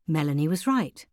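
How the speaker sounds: ordinary delivery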